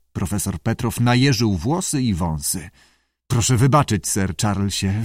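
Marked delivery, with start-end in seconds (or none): none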